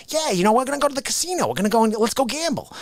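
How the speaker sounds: fast, high excited friend; eager buddy. Retold or imitated. Buddy voiced in story.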